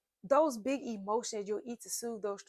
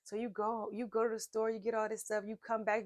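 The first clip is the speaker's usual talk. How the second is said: normal talking voice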